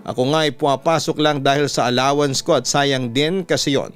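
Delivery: ordinary delivery, nothing marked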